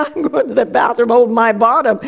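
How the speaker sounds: normal talking voice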